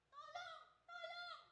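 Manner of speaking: barely audible high call for help; a thin, faint plea from far off; faint cry for help. Retold or imitated. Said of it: "Sumisigaw po siya".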